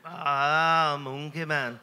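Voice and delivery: a clumsy Liverpool impression of John; imitating John's Liverpool greeting, badly; bad Liverpool accent. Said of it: "Terrible accent that".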